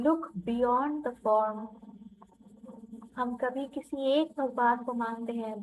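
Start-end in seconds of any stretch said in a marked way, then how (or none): 0.1-1.6 soft, slow meditation voice; guided meditation. Acted. Guiding listeners through a heart meditation, softly and slowly. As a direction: lead them gently inward, softly.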